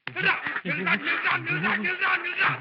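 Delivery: high, urgent repeated calls to stop; frantic high halt orders; chasing shout. Acted. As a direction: shout while giving chase, easing in volume. Running after a man, ordering stop.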